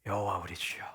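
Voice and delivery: hushed whisper of awe; awed whisper. Acted. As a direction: breathe it out in wonder. Poet awed by the night sky.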